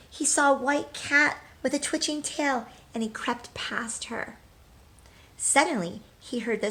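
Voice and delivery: bright, lively storybook read-aloud; reading to children. Acted. Teacher reading a picture book to children, lively.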